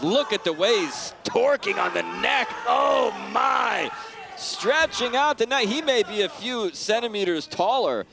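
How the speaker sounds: high excited exclamation. Real, not acted. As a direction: gasp at the neck crank. Neck crank in fight commentary.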